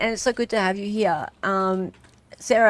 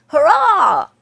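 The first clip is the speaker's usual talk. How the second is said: loud, high cheer; big retold hurrah; celebrating; 8 semitones higher, 11 dB louder than usual. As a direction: cheer it out in triumph. Retelling own celebratory tweet.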